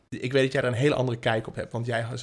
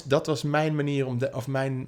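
ordinary delivery

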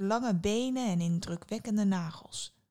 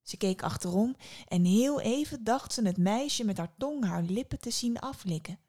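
ordinary delivery, nothing marked

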